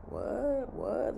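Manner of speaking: hushed, disbelieving reaction; shocked. Retold or imitated. Nurse re-enacting her reaction to being yelled at, quiet shock. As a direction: re-enact stunned disbelief under your breath. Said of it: "Like, I'm shocked".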